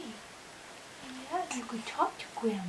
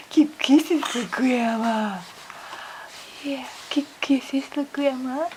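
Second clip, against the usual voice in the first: slow, louder, sing-song ask; voiced coaxing; 3 semitones higher, 9 dB louder, slower than usual. Real, not acted. Grandma coaxes the grandson aloud for kisses, slow and louder.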